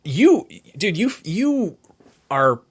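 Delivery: ordinary talk, nothing marked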